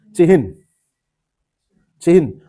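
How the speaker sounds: चिन्ह said as "chi-hin"; full H before N. Shown as a demonstration. Teacher fixing a common mistake, चिन्ह said as "chi-hin". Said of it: "हा पूरा होता है".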